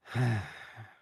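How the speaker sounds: loud, weary exasperated sigh. Acted. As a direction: let out an exasperated breath. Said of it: "gave an exasperated sigh".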